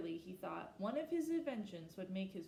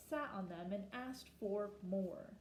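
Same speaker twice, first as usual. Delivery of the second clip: ordinary delivery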